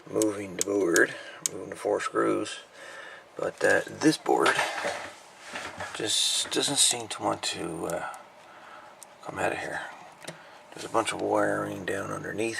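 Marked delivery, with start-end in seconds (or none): none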